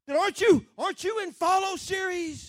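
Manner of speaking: high, loud military bellow; commander's shout. Retold or imitated. Series commander to recruit who passed him, shouting. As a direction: holler after him like an angry officer. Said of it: "he heard the series commander say".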